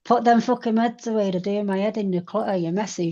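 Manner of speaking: imitated angry nag at a messy friend; mock harsh telling-off. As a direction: bark at her about the clutter. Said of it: "saying it in a nice way".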